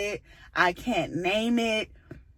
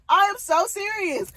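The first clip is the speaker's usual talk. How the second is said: laughing insistence; 11 semitones higher than usual. Retold or imitated. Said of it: "I'm like".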